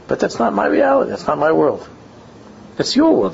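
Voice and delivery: high-pitched, emphatic protest; mock insistent boy. Rabbi voicing the boy's imagined speech, mock emphatic. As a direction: push it up, indignant and certain. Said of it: "That's what he was saying".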